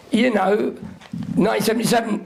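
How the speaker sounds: ordinary delivery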